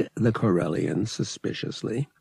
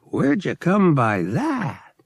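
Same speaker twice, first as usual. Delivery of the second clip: a higher, put-on gambler's voice, cordial; smooth gambler; 9 semitones higher, 4 dB louder, slower than usual. Lyle inviting Han to the sabacc table, cordial. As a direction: wave him over with easy charm.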